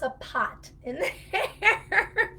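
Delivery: a light mock Massachusetts mother saying pot for part; mom's Boston accent. Imitating her Massachusetts mother to students, Boston accent. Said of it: "that's how she would say it to me".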